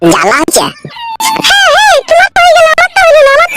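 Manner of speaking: high falsetto woman, whiny and hurt; aggrieved girlfriend in falsetto; whining woman. Acted. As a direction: complain in a girlish voice.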